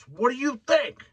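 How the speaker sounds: high, sarcastic mock snap; retold attitude. Homeless man answering the driver, high sarcastic attitude. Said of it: "With, like, fucking attitude".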